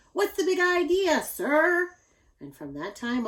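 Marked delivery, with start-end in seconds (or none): 0.1-1.9 loud, high scolding exclamation; a giant mom throwing up her hands; exclaiming mother. A narrator's reading. Mother scolds her son for the carved coast, loud and high. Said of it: "she exclaimed".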